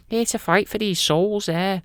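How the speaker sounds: high-pitched comic Scouse voice; Scouse Jesus. Acted. Jesus greeting the Antichrist over souls, high Scouse voice. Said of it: "Don't know why he's Scouse".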